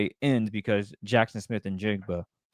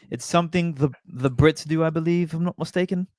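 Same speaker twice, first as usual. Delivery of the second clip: put-on British accent; 4 semitones higher than usual. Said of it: "is that your British accent?".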